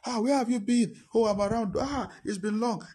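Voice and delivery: high, bright reunion cries; excited greeting. Acted. Old friends greeting, voiced.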